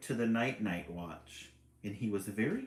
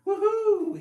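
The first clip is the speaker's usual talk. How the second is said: much higher, loud hooting call; loud high owl hoot; 13 dB louder than usual. Acted. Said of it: "he called".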